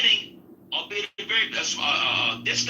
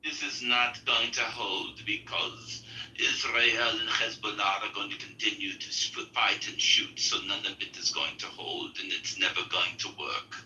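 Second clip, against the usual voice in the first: low murmured imitation; 13 semitones lower, 8 dB quieter than usual. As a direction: mumble it low like a pessimist. Quoting Igor's gloomy prediction.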